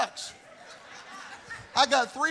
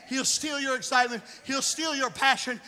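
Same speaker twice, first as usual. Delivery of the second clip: usual speaking voice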